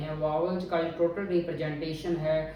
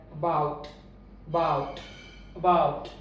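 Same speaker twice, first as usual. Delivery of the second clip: about with a weak A and a strong BOUT; stress on second syllable; much slower than usual. Shown as a demonstration. English teacher models about for learners, stress on BOUT. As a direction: hit BOUT in about, keep the A faint. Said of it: "about ऊंचा है".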